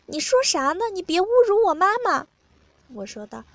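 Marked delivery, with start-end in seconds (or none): 0.1-2.3 high put-on indignant voice; acted high-pitched objection; indignant protest. A narrator's reading. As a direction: protest in a higher voice, louder at the end. Narrator voices an offended partner.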